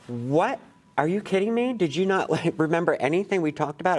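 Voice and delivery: shocked disbelief. Retold or imitated. Retelling reaction to student not writing.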